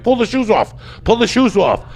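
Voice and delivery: urgent command. Retold or imitated. Shouting orders in fight.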